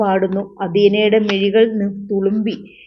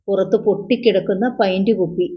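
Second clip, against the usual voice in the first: usual speaking voice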